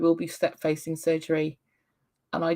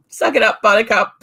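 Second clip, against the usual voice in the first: louder, no-nonsense mum; tough-love mum voice; 2 semitones higher, 8 dB louder than usual. Retold or imitated. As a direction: brush off the complaint like a brisk mum. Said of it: "my mummy voice is just like".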